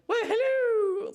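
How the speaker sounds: high, loud, slow, drawn-out falsetto British nanny; Mrs Doubtfire voice. Retold or imitated. Teasing her English husband with a mock Mrs Doubtfire falsetto. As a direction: swoop way up into a hammed-up old nanny hello. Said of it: "like, Missus Doubtfire".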